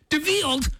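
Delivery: DeVilled? asked with stress on VILL, puzzled; questioning DeVilled; puzzled mispronunciation. Retold or imitated. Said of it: "Robot, get it together".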